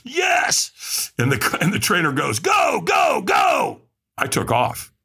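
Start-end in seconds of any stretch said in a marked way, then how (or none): 0.0-1.1 high, all-out cry of yes; fed-up firewalker screams yes back at the trainer; screamed yes. Retold or imitated. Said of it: "I screamed at the top of my lungs". 2.4-3.8 high shouted go go go; urgent go. Retold or imitated. Trainer launches him onto coals.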